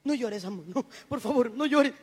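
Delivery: husband comforting his crying wife, tender and hushed; tender consoling. Retold or imitated. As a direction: hush her tears like a softened husband. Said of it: "deja de gritar a consolar".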